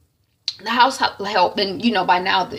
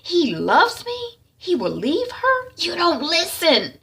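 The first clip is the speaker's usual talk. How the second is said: higher, slow mocking rebuke; scornful scolding; 9 semitones higher, slower than usual. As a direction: parrot her hopes back with scorn. Sister scolds and mimics.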